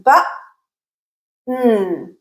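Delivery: "B", long silent hold, then a hummed "N"; glottal stop broken down. Shown as a demonstration. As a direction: say "B" as a cut-off "buh", hold, release into "N". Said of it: "So listen".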